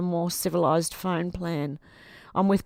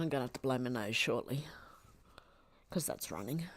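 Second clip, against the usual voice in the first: whisper; 6 semitones lower, 12 dB quieter, faster than usual. Real, not acted. Crying, nose running, aside.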